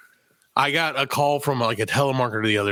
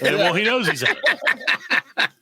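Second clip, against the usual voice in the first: laughing; 8 semitones higher, much slower than usual. Real, not acted. Teasing a friend's catchphrase.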